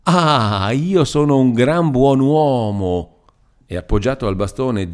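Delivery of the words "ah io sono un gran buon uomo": slow, higher, sneering drawl; mocking irony. A narrator's reading. Old man repeating Demetrio's words: slow smiling mockery. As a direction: stretch it out with a smirk. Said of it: "sempre sorridente".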